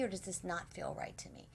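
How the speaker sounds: soft whisper to yourself. Real, not acted. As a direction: whisper it like a private doubt. Inner question to oneself.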